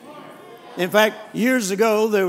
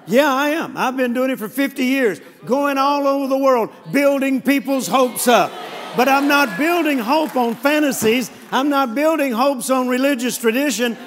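higher, emphatic sermon build to a loud peak; preaching at full force; 4 semitones higher, 4 dB louder than usual. Real, not acted. Preacher proudly owning his critic's charge of building hopes up, full-force preaching. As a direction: build it into a triumphant proclamation.

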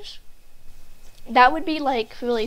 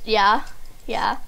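normal talking voice

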